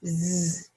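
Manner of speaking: "z" as a sustained buzz; isolated first sound. Shown as a demonstration. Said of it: "I hear the sound".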